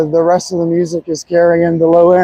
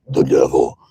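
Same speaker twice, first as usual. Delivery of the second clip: low growled devil voice, much lower; demon voice; 4 dB quieter than usual. Pretend. Joking about answering her as the devil, low demonic growl. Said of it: "and be like".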